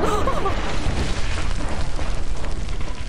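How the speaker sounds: loud startled gasp; sharp gasp. Real, not acted. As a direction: gasp in shock at the sight. Sudden sight of the bridge.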